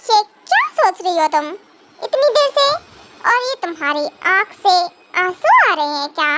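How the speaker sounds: normal talking voice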